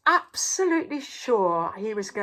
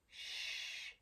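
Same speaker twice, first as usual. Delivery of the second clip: soft whispered shh; quiet hush of an amazed crowd; 17 dB quieter than usual. A narrator's reading. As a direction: hush everyone. Amazed silence.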